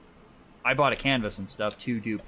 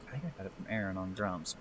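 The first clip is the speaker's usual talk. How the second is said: normal talking voice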